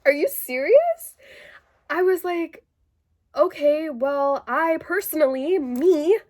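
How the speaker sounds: high, loud exasperated disbelief; outraged inner voice. Retold or imitated. Telling viewers what she wanted to shout at the psychiatrist. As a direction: let the outrage out as if you said it. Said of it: "I didn't say like that to him, guys".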